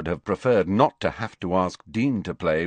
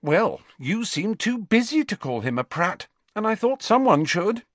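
indignant retort; 12 semitones higher than usual. A narrator's reading. Ginny retorts to captain's rebuke.

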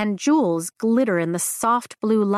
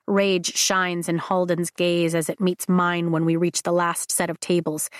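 ordinary delivery

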